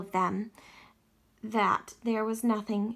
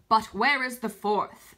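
louder, grave lion king asking; a lion's solemn question, lightly voiced; hint of a regal lion's rich voice; deep, rich lion voice; 5 dB louder than usual. A narrator's reading. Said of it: "His voice was deep and rich".

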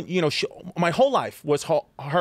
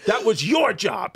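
dad voice; 6 semitones higher, slower than usual. Retold or imitated. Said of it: "And he goes".

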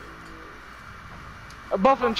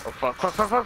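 ordinary delivery

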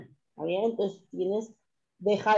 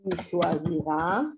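slow, spaced-out dictation of choisira and choisirez; 4 semitones lower, 4 dB quieter, slower than usual. Shown as a demonstration. Teacher dictates the conjugation to students, slow and clear. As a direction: dictate each form slowly for writing. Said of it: "dictation drill".

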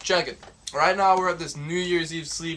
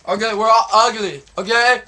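exasperated; 4 semitones higher, 8 dB louder than usual. Real, not acted. Exasperated giving in to teasing.

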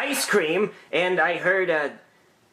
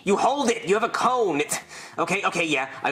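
ordinary talk, nothing marked

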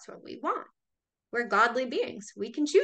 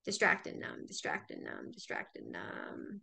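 fading whisper; 7 dB quieter than usual. Acted. Numbing mantra to viewers, whispered and fading. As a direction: let the words dissolve to a hush.

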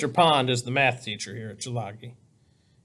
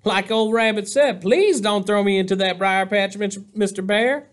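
higher, louder playful character voice; mock pleading, pitched up; Possum quoting Rabbit; 6 semitones higher, 4 dB louder than usual. A narrator's reading. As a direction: play the trickster begging in jest. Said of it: "Possum chuckled".

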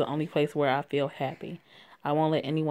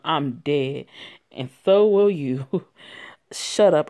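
louder, harsh cornered man with nervous laughs; angry panicked abuser; 3 semitones higher, 5 dB louder than usual. Acted. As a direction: snap back at your friend, scared and furious. Abuser snaps back in panic.

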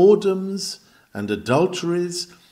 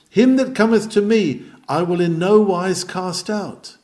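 ringing, raised preacher's proclamation; preaching the text; 5 semitones higher, 4 dB louder than usual. Acted. Preacher proclaiming the promise to sinners, ringing. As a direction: proclaim the promise high and loud.

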